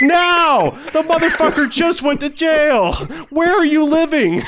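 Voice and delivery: very high, slow, laughing; ranting at a clueless friend, laughing high; laughing disbelief. Retold or imitated. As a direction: howl it in disbelief. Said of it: "I'm like".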